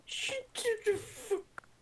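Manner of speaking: high, slow strained cry; high yell. Real, not acted. Yelling at friends in game, high cry. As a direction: wail it out high and long.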